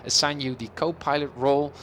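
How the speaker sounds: normal talking voice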